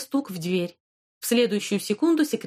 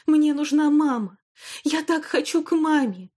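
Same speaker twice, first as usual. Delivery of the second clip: sobbing cry; 7 semitones higher, 3 dB louder than usual. Acted. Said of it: "рыдая и раскачиваясь".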